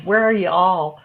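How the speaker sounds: slow, drawn-out American y'all; a hint of American twang, drawn out slowly; mock American accent. Shown as a demonstration. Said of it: "I'll use my American".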